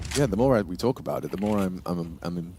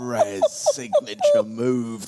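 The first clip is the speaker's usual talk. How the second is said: hyped; 3 semitones higher, much slower than usual. Real, not acted. Hyping a clutch shot.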